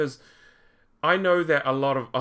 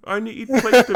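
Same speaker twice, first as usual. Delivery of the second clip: higher-pitched put-on New Zealand accent; mock Kiwi accent; 7 semitones higher, 5 dB louder, faster than usual. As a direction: exaggerate the Kiwi vowels. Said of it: "have to put that on".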